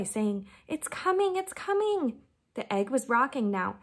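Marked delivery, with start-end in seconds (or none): 0.7-2.2 much higher, thrilled cry; a touch of breathless delight at the egg; mock excited squeal; joyful gasping. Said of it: "gasping with joy".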